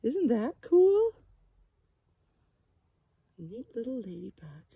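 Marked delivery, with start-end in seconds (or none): none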